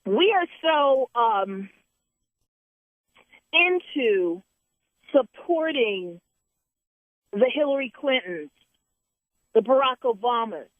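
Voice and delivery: high, intense preaching. Real, not acted. Continues preaching.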